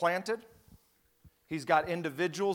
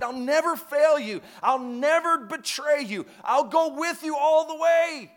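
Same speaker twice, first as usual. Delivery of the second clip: Peter's loud, higher vow of loyalty; boastful Peter; 7 semitones higher, 7 dB louder than usual. Retold or imitated. Peter promising Jesus he will never fail. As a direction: swear it boldly as Peter. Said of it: "Peter, of all people".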